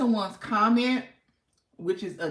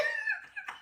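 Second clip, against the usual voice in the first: laugh; 8 dB quieter than usual. Real, not acted. Laughing on live.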